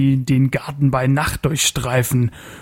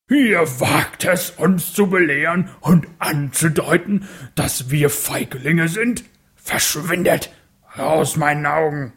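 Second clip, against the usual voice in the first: furious; 3 semitones higher than usual. Acted. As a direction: hurl the insult in fury. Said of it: "färbte sich rot vor Wut".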